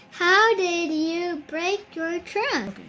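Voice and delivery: ordinary delivery, nothing marked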